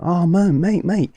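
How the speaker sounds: high-pitched mock sympathy; retold grateful sympathy. Grateful barman hearing his mate is skint, high imitated concern. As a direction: imitate him pleading fondly, pitched right up. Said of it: "he's full of gratitude for me".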